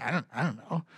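mumbled "I don't know" to his teasing family; sheepish mumble. Retold or imitated. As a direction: shrug it off quietly, a bit embarrassed.

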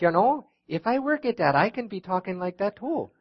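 put-on Minnesota accent. Guest preacher showing the church how Minnesotans talk, high Minnesota accent. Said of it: "I heard a feller talking like this".

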